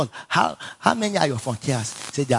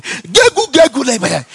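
high, loud burst of mock tongues; sudden high, loud mock tongues; imitating tongues; 10 semitones higher, 8 dB louder, slower than usual. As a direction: shriek the gibberish like the startling minister. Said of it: "tapped his feet and said".

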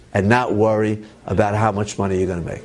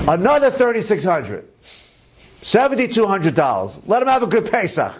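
high, emphatic retold declaration; acted-out eager insistence, high-pitched; insistent generosity; 8 semitones higher than usual. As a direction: announce it grandly, pitch up, waving off objections. Donor insists on doubling the gift.

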